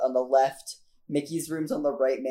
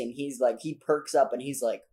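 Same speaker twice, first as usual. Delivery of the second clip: ordinary delivery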